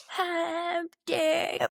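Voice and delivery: high, slow, pearl-clutching cry; mock outrage. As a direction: gasp it out like scandalized voters. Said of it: "y'all would be like".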